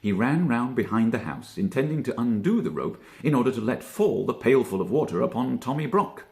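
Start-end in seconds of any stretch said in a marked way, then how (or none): none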